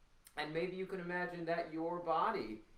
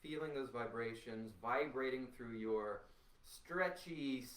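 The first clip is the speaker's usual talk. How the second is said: ordinary delivery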